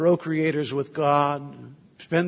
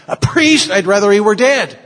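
shrieking, much higher and louder; horrified outburst; 6 semitones higher, 9 dB louder than usual. Acted. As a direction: explode in disbelief at the news. The mother's outburst at Don Bosco.

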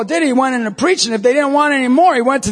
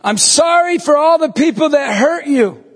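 high, drawn call across distance; 4 semitones higher than usual. Retold or imitated. Priest to strangers across the street, calling out an apology. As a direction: project it to someone far away.

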